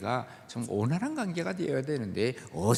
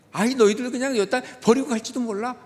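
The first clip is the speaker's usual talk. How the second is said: exasperated God; 6 semitones higher, 7 dB louder than usual. Retold or imitated. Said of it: "하나님께서 그 말씀을 하시는 거예요".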